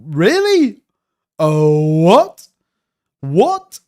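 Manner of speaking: floored by the headline, pitch shooting up; shocked. Real, not acted. Reacting to pardon headline.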